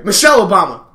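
loud blurted shout; retold screaming. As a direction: burst out shouting after a meek apology. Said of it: "start screaming shit at".

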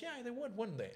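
very high-pitched imitation of a director; mock dismissive reply. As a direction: toss it back in a squeaky-high put-on voice.

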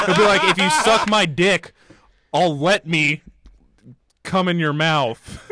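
can barely get it out for laughing; laughing crude quote. Retold or imitated. Guy cracking up while quoting the sauna creep.

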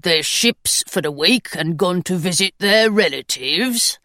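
a grumpy little stone gargoyle agreeing. Acted. Gargoyle speaking.

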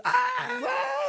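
high-pitched scared scream; high shriek. Retold or imitated. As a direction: shriek like someone jumped. Retold dad's scream.

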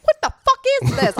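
hammed-up disbelieving yell; mock scream. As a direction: replay the theater yell, half in jest.

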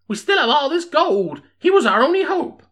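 high, loud townsman's voice; high-pitched plaintive character voice; worried mayor. Acted. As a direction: play an anxious old-West mayor, pitched right up. Voices Arkansas Pete, the mayor.

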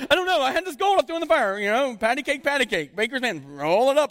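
high, loud, flustered mock innocence; excuse-making Aaron. Aaron excusing himself to Moses, high flustered mock innocence. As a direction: squeak out a guilty excuse. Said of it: "He's like".

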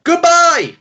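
high, bright goodbye called out; big goodbye. Real, not acted. Podcast hosts calling out a big goodbye on three. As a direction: sing out goodbye to the listeners.

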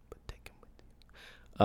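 whispered, sly, just between us; conspiratorial whisper. Pretend. Whispering to listeners to take clients along anyway. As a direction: drop to a secret whisper, like passing on a tip. Sly aside after "I didn't say that.

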